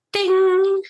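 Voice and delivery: high, loud sung ding; a bright elevator chime, sung out. Retold or imitated.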